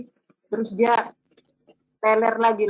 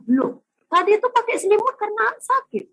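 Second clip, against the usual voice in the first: much higher and louder, astonished; a mother's startled realisation, pitched way up; shocked mother; 13 semitones higher, 9 dB louder than usual. Retold or imitated. Mother realising her son hid under the blanket because he was ill, shocked and loud.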